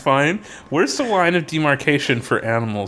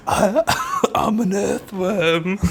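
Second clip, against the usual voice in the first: high, slow mock Scouse; Liverpool accent; 6 semitones higher, much slower than usual. [name] introducing himself to [name], mock Liverpool accent. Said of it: "he had, like, a Liverpool accent or something".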